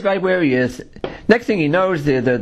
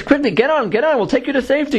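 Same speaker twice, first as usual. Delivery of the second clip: high, hurried mock urging; urgent rescuer; 10 semitones higher, faster than usual. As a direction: act out hurrying someone aboard, pitch raised. Helicopter rescuer urging him aboard.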